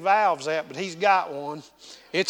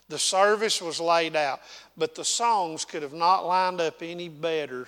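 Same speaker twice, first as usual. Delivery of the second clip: ordinary talk, nothing marked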